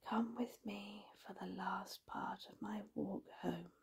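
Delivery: very soft, tired whisper. A narrator's reading. Exhausted Roger the rabbit asking Alex along, whispering. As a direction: whisper it, barely audible with tiredness. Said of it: "when he whispers".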